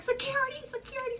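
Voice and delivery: high urgent call for security; nurse calling out. Retold or imitated.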